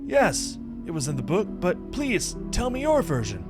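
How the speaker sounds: high-pitched, animated game character; excited companion character. Acted. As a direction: play the eager companion, voice lifted high. Voices eager companion character.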